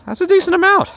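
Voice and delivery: loud squeaky falsetto; squeaky high voice. Pretend. Silly repeat of a remark.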